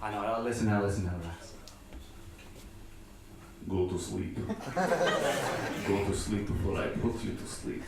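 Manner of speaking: a menacing Russian lullaby voice, half laughing; Russian accent ASMR. Acted.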